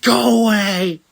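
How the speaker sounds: loud, high drawn-out dismissal; mock shout. Wrestler shooing Kairi away backstage, mock shout. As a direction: stretch out a mock yell.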